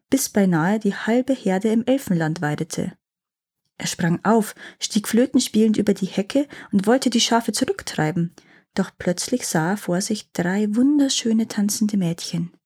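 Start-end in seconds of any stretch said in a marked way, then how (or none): none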